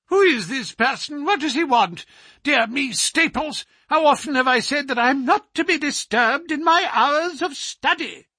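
a peevish scholar scolding his butler, pitched high; high petulant voice. Acted. The scholar refusing a visitor to his butler, high and petulant. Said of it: "I heard a high, petulant, penetrating voice".

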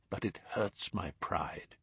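hurts my pride almost under his breath. A narrator's reading. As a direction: say it softly to yourself, defeated. Holmes repeats, more crushed.

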